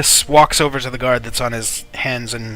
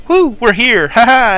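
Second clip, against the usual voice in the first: high, loud whoop and laugh; mock celebration; 11 semitones higher, 8 dB louder than usual. Aris recapping their arrival to the captain, whooping. Character acts out a merry arrival.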